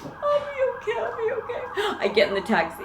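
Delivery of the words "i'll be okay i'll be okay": high, squeaky tearful reassurance; mock weeping in a tiny high voice; imitated crying. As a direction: insist through hammed-up sobs. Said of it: "I'm crying".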